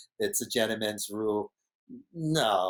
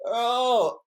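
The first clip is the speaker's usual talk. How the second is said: much higher mock surprise; a sudden squeaked oh; surprised oh; 12 semitones higher, 4 dB louder than usual. Retelling his reaction to Brock, high surprised oh. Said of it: "I'm like".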